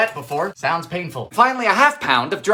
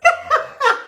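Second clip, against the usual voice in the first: very high squealing laugh; squeaky real laughter; helpless giggle. Real, not acted. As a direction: burst into high-pitched giggles. Cracking up at the recipe.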